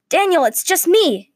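high, loud reassurance under attack; urgent yell. A narrator's reading. Zack ducking Daniel's sword from the floor, urgent yell. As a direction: yell it to stop him swinging. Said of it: "yelled Zack from the floor".

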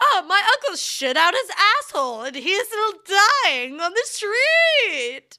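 hammy desperate caller begging; frantic cry for help. Pretend. Mock frantic police call.

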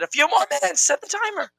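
high, squeaky mock imitation. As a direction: parrot her in a high squeaky voice. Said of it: "I said".

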